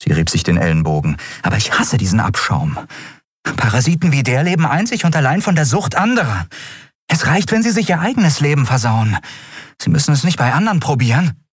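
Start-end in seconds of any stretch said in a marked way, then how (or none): 1.4-11.4 put-on higher, fast, indignant young woman; young woman railing against a drug dealer; Andriel, heated. A narrator's reading. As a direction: rattle off her contempt quickly. Andriel voiced in story.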